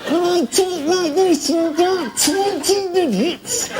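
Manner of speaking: very high, small squeaky voice, slow; a little man talking from inside a cigarette packet; tiny voice from a box. Acted. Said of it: "a character who used to talk from inside a box".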